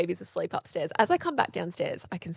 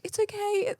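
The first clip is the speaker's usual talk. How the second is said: high, gentle reassurance; soothing through tears; 13 semitones higher, 3 dB quieter than usual. Retold or imitated. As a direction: comfort the child while upset. Crying mum soothing toddler.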